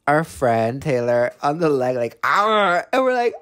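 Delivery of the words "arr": high imitated snarl; biting dog snarl.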